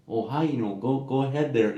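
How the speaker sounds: a hint of friendly North Dakota folksiness; North Dakota nice. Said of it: "us being in North Dakota nice".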